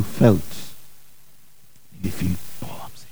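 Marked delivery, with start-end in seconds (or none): 2.0-3.1 breathy awed whisper. Retold or imitated. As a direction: hush it like a secret. Whispered mock testimony.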